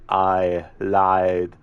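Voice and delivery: action-hero movie impression, forceful; Arnie. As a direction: play the menacing action hero interrogating his captive. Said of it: "an Arnie movie scene impression".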